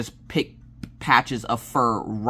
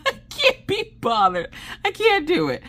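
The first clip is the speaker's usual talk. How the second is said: very high squeal through laughter; real tearful giggling squeak; helpless laughter. Real, not acted. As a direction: wheeze it out, nearly crying with laughing. Repeats it while cracking up.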